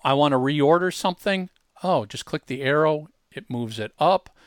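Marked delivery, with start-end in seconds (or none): none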